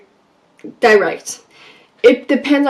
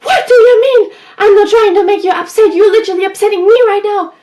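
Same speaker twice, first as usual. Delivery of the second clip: much higher, louder angry retort; getting mad; 9 semitones higher, 4 dB louder than usual. Retold or imitated. Insecure girl snapping back at Joy, loud and high. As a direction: fire it back, indignant and upset. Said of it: "she was getting mad".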